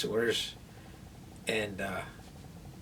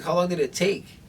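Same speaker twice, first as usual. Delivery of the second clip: higher, louder retold disbelief; incredulous question, pitched up; surprised question; 7 semitones higher, 6 dB louder than usual. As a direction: act out being taken aback on waking. Acting out his surprise on waking.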